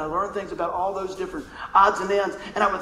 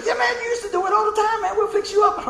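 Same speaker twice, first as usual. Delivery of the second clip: high, far-off put-on coach voice; karate teacher; 14 semitones higher than usual. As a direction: play the laughing karate teacher. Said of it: "And the karate teacher".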